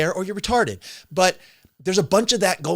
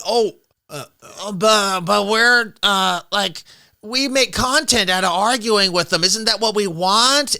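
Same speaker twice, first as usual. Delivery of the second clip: a raised, louder mock of their stammering reasoning; half-hearted mock of a clueless streamer; mocking dumb voice; 3 semitones higher, 6 dB louder, slower than usual. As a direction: play them as hesitant and dim, lightly put-on. Mocking the other side's thinking.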